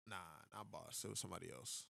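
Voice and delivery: usual speaking voice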